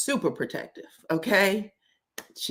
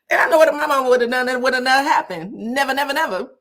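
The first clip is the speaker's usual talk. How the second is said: louder, higher and fast insistence; emphatic; 5 semitones higher, 5 dB louder, much faster than usual. Real, not acted. Telling viewers what her mama would have done, emphatic and loud. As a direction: drive every never home.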